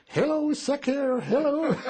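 put-on French boy shouting to the passing GIs; kid calling out. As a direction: call it out like a proud little boy, laughing at the end. Kid shouting the taught words.

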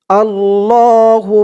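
আল্লাহুম with the ম hummed through the nose; nasal ghunnah. Shown as a demonstration. Teacher demonstrates ghunnah on আল্লাহুম to viewers. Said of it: "বলে গুন্নাহ করতে হবে".

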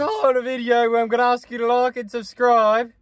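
high, far-off influencer voice; hammed-up YouTuber voice. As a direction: play a bubbly YouTuber begging for subs. Mocking a YouTuber outro.

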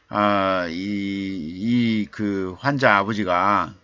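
ordinary delivery

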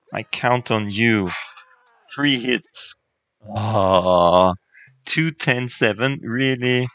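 loud, eager cheering at the rolls; root hard for the hits; excited. Real, not acted. Dice rolls during fight.

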